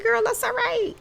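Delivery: telling a friend it's fine, high and sing-song; breezy reassurance. Retold or imitated. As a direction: toss it off high and cheerful. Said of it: "And I said".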